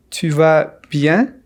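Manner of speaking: Tu vas bien slowly, word by word; careful slow question for learners; slow model. Shown as a demonstration. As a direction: space out Tu vas, then bien. Slow model, then natural repeat.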